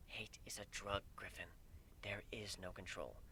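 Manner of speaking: a grave goddess murmuring a prophecy of doom; hushed solemn goddess. Acted. Goddess warning Griffin his hate will kill him, hushed and grave.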